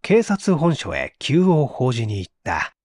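ordinary delivery

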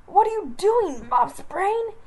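high, loud angry snarl; growling. Acted. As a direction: snarl at her from the bank. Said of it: "he growled".

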